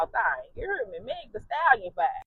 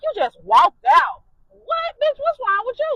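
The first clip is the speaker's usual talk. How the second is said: much higher and louder, scolding disbelief; outraged friend; 11 semitones higher, 7 dB louder than usual. Retold or imitated.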